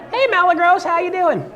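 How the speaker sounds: high, loud, overfriendly hello; very high chummy voice; cheesy greeting. Pretend. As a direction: greet like a fake-friendly salesman. Mock greeting with mispronounced name.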